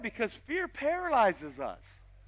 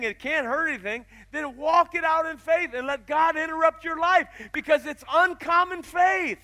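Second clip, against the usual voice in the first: preaching at full force; 6 semitones higher, 9 dB louder than usual. Acted. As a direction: drive it home to the congregation. Preaching climax.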